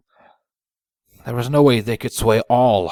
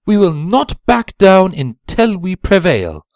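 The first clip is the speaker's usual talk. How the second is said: higher, proud rhyming voice; confident zebra voice; 11 semitones higher, 7 dB louder than usual. A narrator's reading. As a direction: speak with sure confidence. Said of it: "with a confident smile".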